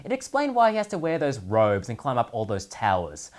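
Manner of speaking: ordinary talk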